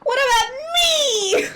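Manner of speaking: high, drawn-out whine; whiny kid voice. Acted. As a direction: whimper it like a sulking child. Whining about being left out.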